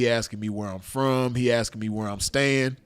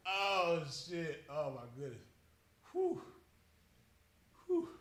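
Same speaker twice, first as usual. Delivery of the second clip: soft, higher, drained sigh of words; spent from laughing; 5 semitones higher, 13 dB quieter than usual. Real, not acted. Storyteller collecting himself after laughing, spent and soft. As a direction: recover weakly after a laughing fit.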